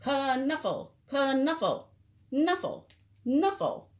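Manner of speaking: high, fretful repeating of knuffle; a small child whining her bunny's name; fussing little girl. Acted.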